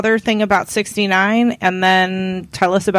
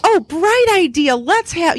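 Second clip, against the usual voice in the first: excited idea voice; 9 semitones higher, 4 dB louder than usual. Retold or imitated. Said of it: "I was like".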